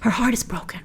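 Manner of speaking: soft unvoiced whisper. Real, not acted. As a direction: breathe it like a sad secret.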